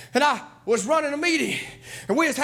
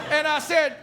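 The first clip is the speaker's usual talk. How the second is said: ordinary talk, nothing marked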